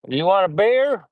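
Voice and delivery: mock Southern host; Southern accent. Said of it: "too much of a Southern accent".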